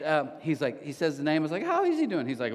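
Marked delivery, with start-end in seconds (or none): 1.7-2.3 high, chirpy retold question; light, high-voiced inquiry; mock innocent question. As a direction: pitch it way up, feigning innocence. Retelling own question to workout friend.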